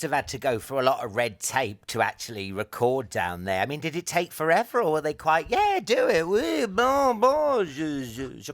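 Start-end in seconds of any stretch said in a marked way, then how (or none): 5.5-8.5 high-pitched hammed-up French accent, easing down; mock French official. Officials granting permission to record, hammed-up French accent.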